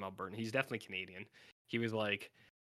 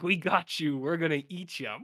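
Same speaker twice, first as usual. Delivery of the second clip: loud, high mock-menacing gloat; spring the trap with a hammed-up cackle-ready threat; playful cannibal threat, pushed loud; gleeful cannibals; 6 semitones higher, 9 dB louder than usual. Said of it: "They're like".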